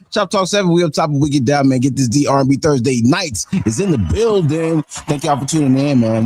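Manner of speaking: high, pumped DJ announcer call; radio host hype intro. Acted.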